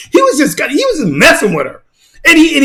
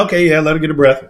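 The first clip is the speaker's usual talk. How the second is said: imitating the fighter; 8 semitones lower than usual. Male fighter letting the woman catch her breath, low and mocking. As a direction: lightly voice him going easy on her. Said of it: "He kept like".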